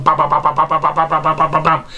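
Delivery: even string of ba-ba-ba sounds; imitated stream of signals; rapid-fire babble. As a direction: rattle off the syllables like a machine. Imitates rapid signals firing in brain.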